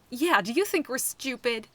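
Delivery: loud, mock-offended outburst; exclaiming. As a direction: exclaim it, playing along with the bluff. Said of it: "she exclaimed".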